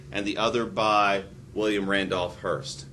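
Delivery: usual speaking voice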